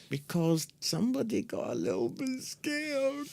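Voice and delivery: high, soft mocking sing-song; teasing. Teasing co-host about being scared, soft mocking sing-song.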